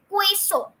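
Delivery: queso said KWAY-so, with a w after the k; mistaken pronunciation. Shown as a demonstration. Teacher voicing what students would wrongly say, KWAY-so for queso. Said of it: "you would be pronouncing".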